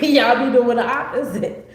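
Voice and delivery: says it through a loud laugh; laughing while teasing the audience. Real, not acted.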